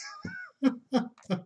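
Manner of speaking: high giggling burst; high laughter. Real, not acted. Viewer amused by own ghost joke, laughing.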